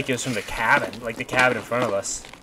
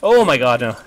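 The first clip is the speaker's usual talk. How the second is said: panicking gamer; 7 dB louder than usual. Real, not acted. Dying in firefight.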